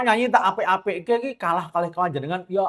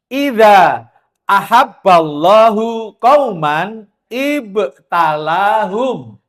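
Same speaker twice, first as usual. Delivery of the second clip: slow, louder chanted Arabic; hadith recitation; 2 semitones higher, 7 dB louder, much slower than usual. Acted. Preacher reciting the hadith to the congregation, slow and drawn out. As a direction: intone the hadith slowly, each word stretched.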